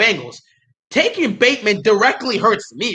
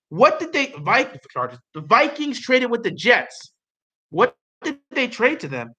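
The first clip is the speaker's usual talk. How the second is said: ordinary talk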